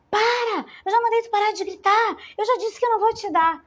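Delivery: high, loud, fast scolding shout; parent yelling. Retold or imitated. Mother at the store yells at her shrieking child, loud and fast. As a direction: bark at the screaming kid to stop, fast.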